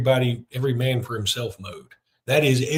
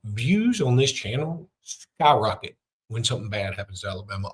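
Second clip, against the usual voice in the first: ordinary talk, nothing marked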